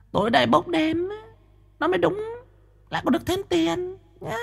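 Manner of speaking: very high, soft, scheming woman's voice; whispering medium. A narrator's reading. Medium whispering to Quý, very high and soft. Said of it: "Nó thì thầm".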